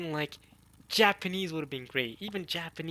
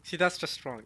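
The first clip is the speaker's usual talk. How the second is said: ordinary talk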